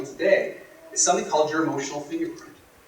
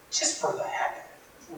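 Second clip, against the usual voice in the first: whispered thought; 12 semitones higher, 9 dB quieter, slower than usual. Retold or imitated. Inner thought, breathed out.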